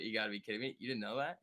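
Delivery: ordinary delivery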